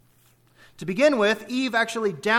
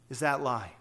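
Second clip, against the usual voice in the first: ordinary talk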